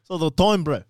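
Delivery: "So the time" with a mock accent; South African sounding. Attempt heard as a South African accent. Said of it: "That sounds South African".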